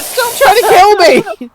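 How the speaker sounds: high, slow loud shout; loud yell. Real, not acted. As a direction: yell it in protest. Friend attacking her in game.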